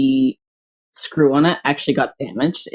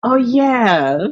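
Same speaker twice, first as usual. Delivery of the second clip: Kool-Aid Man cry; 9 semitones higher, 4 dB louder than usual. Pretend. Bursting through a wall, Kool-Aid style.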